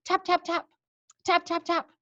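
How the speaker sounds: high, crisp tap imitation; boy tapping the bottle on the door. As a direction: imitate knocking.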